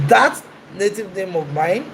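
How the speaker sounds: normal talking voice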